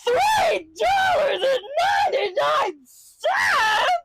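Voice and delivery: high, slow, shrieking disbelief at the price; cartoon dog shrieks at a pricey pretzel; shocked dog. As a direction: gasp out the price like it's robbery. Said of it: "the dog whips around and goes".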